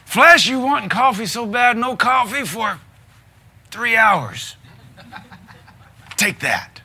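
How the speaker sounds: loud, high hammed-up scolding of the flesh; mock rebuke. Preacher mock-scolding his flesh over coffee, loud and high. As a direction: lay down the law to your cravings, playfully.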